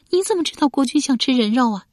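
high, incredulous question; high-pitched wifely disbelief; disbelieving wife. A narrator's reading. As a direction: question him as the shocked wife.

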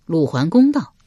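plain reading voice

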